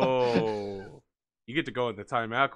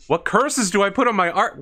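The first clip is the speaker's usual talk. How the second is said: startled exclamation; 9 semitones higher, 10 dB louder than usual. Real, not acted. Reacting to hearing of cursed art.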